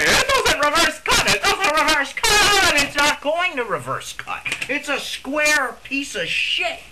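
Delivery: high, loud mocking imitation; mock the reviewer in a squeaky voice. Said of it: "this guy's reviewing Type C and saying".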